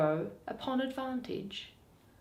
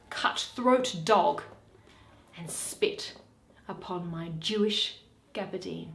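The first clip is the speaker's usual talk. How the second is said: ordinary talk, nothing marked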